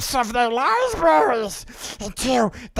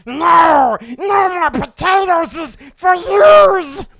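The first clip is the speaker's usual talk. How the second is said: loud, higher furious Gollum; Gollum shout; 4 semitones higher, 7 dB louder than usual. Acted. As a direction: scream it as raging Gollum. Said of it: "that's my Golem impression".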